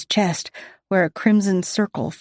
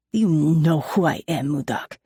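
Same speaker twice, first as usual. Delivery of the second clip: put-on angry Russian accent; 2 semitones lower than usual. A narrator's reading. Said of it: "He spits through clenched teeth".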